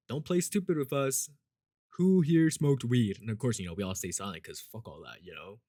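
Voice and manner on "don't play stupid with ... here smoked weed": louder, higher mock interrogation; imitated stern principal. Principal questioning students on the bleachers, stern. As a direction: push it out like a principal demanding answers.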